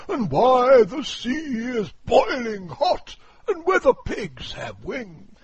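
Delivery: high, loud, booming walrus; a pompous walrus declaiming loudly. Acted. Walrus voiced.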